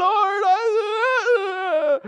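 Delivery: high, tearful whimper; whiny crying plea. Retold or imitated. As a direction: sob it out like a spoiled child. Mimics whining prayer to God.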